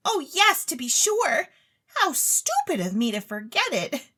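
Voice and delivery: put-on high, eager young lady pleading; a rich, silly girl begging to go on stage; Claribel's voice. Claribel begs the chemist for stage talent, high and eager.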